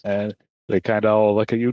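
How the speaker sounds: ordinary talk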